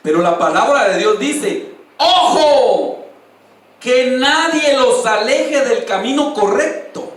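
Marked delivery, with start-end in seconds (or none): 2.0-7.1 loud, raised, drawn-out warning; emphatic warning. Real, not acted. Preacher warning flock off wrong paths, emphatic warning. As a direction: call out the alert slowly and firmly.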